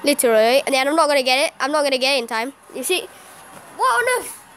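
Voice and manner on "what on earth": high-pitched, incredulous protest; exasperated disbelief. Real, not acted. Player protesting an unbeatable matchup, exasperated. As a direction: complain in helpless outrage.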